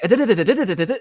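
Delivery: high, loud, breathless mock babble; hammed-up frantic jabbering; imitated babbling. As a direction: rattle off nonsense as if out of breath. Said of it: "just be like".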